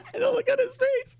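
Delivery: squeaking with laughter; high breathless laughter at a silly bear picture; laughing. Real, not acted. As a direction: laugh through the words.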